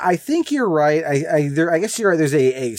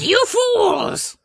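high shouted villain taunt; booming echoing villain monologue. Retold or imitated. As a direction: shriek it like Cobra Commander. Imitating Cobra Commander's shout.

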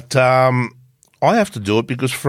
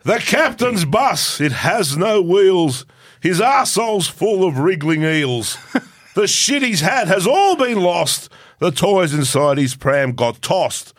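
lightly recited comic poem, slow at the start; a hint of a poetry reading; poem recital; 7 semitones higher, slower than usual. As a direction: recite the rude verse with giggles. Reads his weekly poem.

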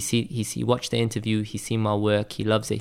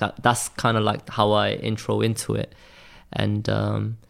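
usual speaking voice

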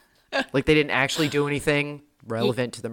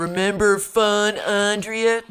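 loud, high, drawn-out mock condescension; snotty; 6 semitones higher, 4 dB louder, slower than usual. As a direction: rub it in slowly, imitating a snide teen. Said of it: "have to be snotty to her".

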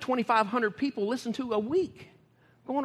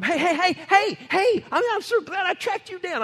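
high, loud calls of hey; running up overjoyed; excited greeting; 10 semitones higher, 8 dB louder than usual. Acted. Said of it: "somebody gonna come running".

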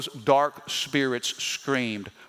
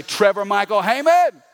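loud, high full-name call; parent calling out; 5 semitones higher, 6 dB louder than usual. Retold or imitated. Parent summoning a child in trouble, loud and sharp. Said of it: "Sometimes I gotta say".

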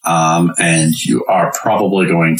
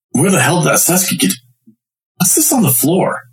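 raised, fast gruff guard voice; 12 semitones higher than usual. Acted. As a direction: bark it like a suspicious guard. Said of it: "you hear a deep voice".

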